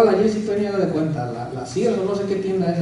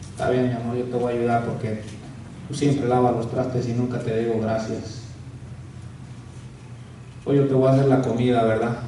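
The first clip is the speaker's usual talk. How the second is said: soft, low, tender words with a chuckle; sweet husband; 7 semitones lower, 5 dB quieter than usual. Pretend. Husband promising his wife to cook, soft and tender. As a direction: coax her gently like a doting husband.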